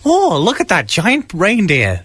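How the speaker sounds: mock Virginia spectator, gently hammed; awful Virginia accent. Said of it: "That's my awful Virginia accent".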